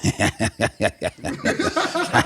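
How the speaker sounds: long, rapid mimicked chuckling; imitated giggle. Sidekick beside the heckler, long imitated giggling. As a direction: laugh on and on like the sidekick. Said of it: "all he did was this".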